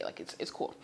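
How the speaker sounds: whispered, shrugging it off; whispered shrug. Real, not acted. Student whispering to herself that the noise is fine. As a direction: breathe it out like it doesn't matter. Her own thought, shrugging it off.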